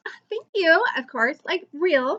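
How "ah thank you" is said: higher, flattered re-enacted thanks; gushing thanks. YouTuber thanking an admirer, acted-out gushing. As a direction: gush gratitude with a lifted pitch.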